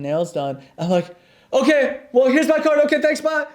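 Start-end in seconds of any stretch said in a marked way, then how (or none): 1.5-3.5 high, flustered and hurried; nervous rush. Retold or imitated. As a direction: babble your way out, panicking. Nervous exit from a nail salon.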